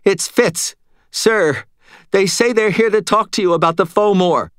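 very high, loud, panicky voice; frantic calling out. A narrator's reading. Captive Fitz announcing visitors to sir, frantic. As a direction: call out frantically to your boss. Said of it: "he called out frantically".

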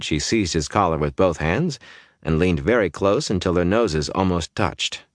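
ordinary delivery, nothing marked

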